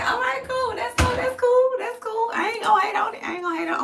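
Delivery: high, whiny fake sob; mock crying. Pretending to cry over a troll, high fake sobbing.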